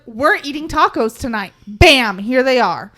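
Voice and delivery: decisive announcement. Pretend. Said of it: "I just want to be like".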